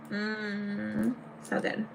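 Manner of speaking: savoring a sour candy; eating sour candy. Real, not acted. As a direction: mouth full of sour candy. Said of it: "I make ugly faces 'cause it's so sour".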